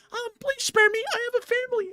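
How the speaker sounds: tiny, very high squeaky plea; Shark Tale shrimp. Retold or imitated. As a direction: beg for your life like a cartoon shrimp. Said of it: "The one that was like".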